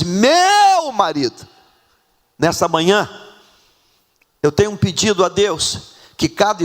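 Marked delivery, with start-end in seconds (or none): 0.0-1.5 high, long-swelling "meu"; adoring cry. Acted. Preacher contrasting the wife's words with insults, adoring cry. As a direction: let "meu" soar, then fall on "marido". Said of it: "Ela diz".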